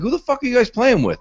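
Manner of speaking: a high, incredulous demand; baffled outburst. Retold or imitated. Asking roommates who they're playing Halo against, high incredulous outburst. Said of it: "I'm like".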